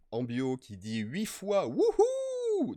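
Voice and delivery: slow build into a high, loud whoop; cheering. Real, not acted. Streamer cheering at chat, loud high whoop. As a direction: cheer at the good news from chat.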